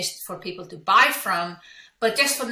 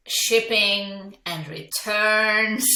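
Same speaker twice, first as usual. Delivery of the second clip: ordinary talk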